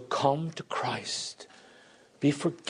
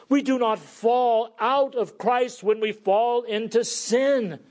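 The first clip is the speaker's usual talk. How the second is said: higher, ringing repeat of the key line; preaching; 8 semitones higher, 4 dB louder than usual. Acted. Preacher repeating his key truth to the church, louder. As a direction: drive the truth home to the congregation. Repeats the opening declaration, stronger.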